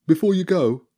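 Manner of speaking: high, loud rat; Bobby. Acted. Rat stops departing children, high eager voice. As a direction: call out hopefully as a rat.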